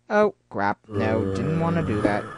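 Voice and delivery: ordinary talk, nothing marked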